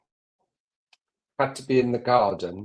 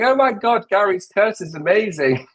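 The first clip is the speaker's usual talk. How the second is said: mock viewer gush; 8 semitones higher, 5 dB louder than usual. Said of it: "everybody's going to be saying".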